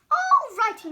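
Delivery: high, loud, cheery; cheerful. Acted. Arnie cheerful.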